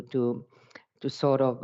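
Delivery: normal talking voice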